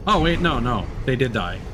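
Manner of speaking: ordinary talk, nothing marked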